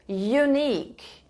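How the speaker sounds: "Unique" said slowly with a clear "yu" start; slow teaching model. Shown as a demonstration. Teacher modelling the word for the class, slow and clear. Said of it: "começou com som de ya".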